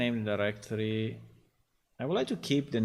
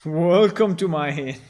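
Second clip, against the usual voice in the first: innkeeper voice; 5 semitones higher, 10 dB louder than usual. Retold or imitated. Echoes the innkeeper clip.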